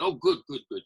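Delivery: delighted Santa. Retold or imitated. Santa pleased with the girl's answer, high and delighted.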